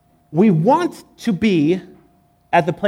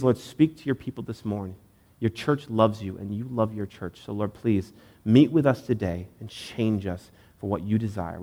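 heartfelt prayer; 5 semitones lower than usual. Real, not acted. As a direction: pray sincerely, easing into a hushed close. Leads a prayer aloud.

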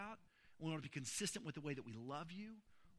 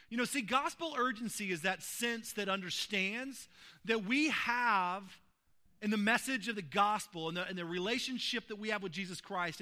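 loud, high, slowing preacher's climax; preaching at full force; 3 semitones higher, 9 dB louder than usual. Acted. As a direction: lift the congregation toward the big claim. Preaching climax on gospel urgency.

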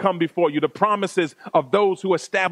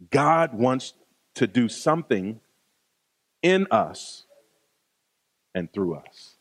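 hushed sermon close; 7 semitones lower than usual. Real, not acted.

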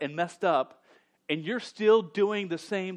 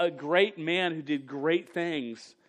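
ordinary talk

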